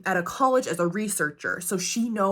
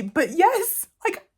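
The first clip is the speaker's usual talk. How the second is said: usual speaking voice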